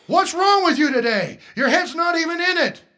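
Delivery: loud, high coach's scolding. Acted. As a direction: bark it like an angry coach. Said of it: "Do coaches talk like that?".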